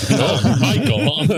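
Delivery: high teasing squeal of a name. Real, not acted. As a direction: tease him with a high squeal. Teasing reaction to innuendo.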